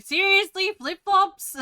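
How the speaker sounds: dad's hammed-up outrage at the word flip-flops; mock disbelief. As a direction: squeal the disbelief, hamming it up. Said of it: "But he's like".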